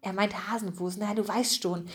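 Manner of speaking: soft, hushed explanation; whispered. A narrator's reading. A friend explains the odd word to Bertram, hushed. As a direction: explain it under the storyteller's voice. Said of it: "flüsterte Bertram den anderen zu".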